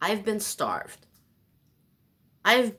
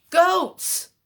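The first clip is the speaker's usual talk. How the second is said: loud high exclamation of discovery; sudden idea; 12 semitones higher, 9 dB louder than usual. Real, not acted. Struck by an idea about goats, excited shout. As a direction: shout it like a eureka.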